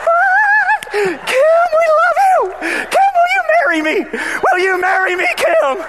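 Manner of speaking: high, loud, gushing disbelief; overjoyed amazement. Retold or imitated. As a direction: burst out in grateful joy. Kids amazed babysitter covered for them.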